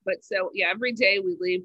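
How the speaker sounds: ordinary delivery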